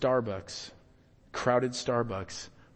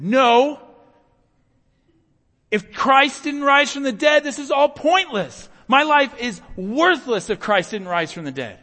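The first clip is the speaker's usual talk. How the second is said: full-force shout, much higher and louder; screamed; 14 semitones higher, 15 dB louder than usual. Retold or imitated. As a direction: yell it out desperately in a crowded café. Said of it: "And I screamed".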